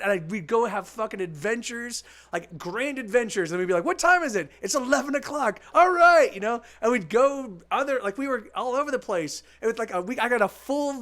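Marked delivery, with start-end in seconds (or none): 3.8-6.3 put-on bright, eager kids calling out the time; excited kid voices. Friend recalls kids checking the time, excited. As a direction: play the twelve-year-old ready to go. Kids planning summer adventures.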